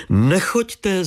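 high-pitched call. A narrator's reading. Lady calls to the detective from the window, calling out. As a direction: call out to stop someone leaving. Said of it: "zavolala paní Věra".

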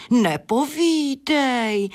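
high, slow, awed breath; breathed in admiration. Acted. Hen breathes in awe at the bat's night life. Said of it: "vydechla Adéla obdivně".

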